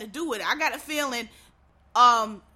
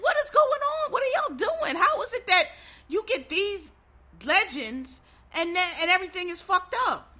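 much higher, exasperated disbelief; incredulous outcry; 5 semitones higher than usual. Retold or imitated. Viewers reacting to a botched show, incredulous and high. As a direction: throw up your hands in shocked disbelief. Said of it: "It was like".

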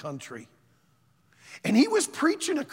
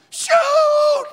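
high, loud defiant yells; yelling out; 12 dB louder than usual. Retold or imitated. Preacher voicing Alberto daring the gunmen, loud shout. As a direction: dare them to shoot, full shout. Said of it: "he starts yelling out".

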